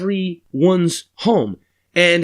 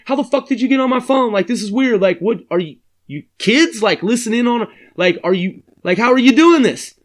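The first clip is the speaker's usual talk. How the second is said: much higher, fast, louder disbelief; baffled caller; 6 semitones higher, 3 dB louder than usual. Retold or imitated. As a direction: demand answers, rattled. Caller demands how they got in.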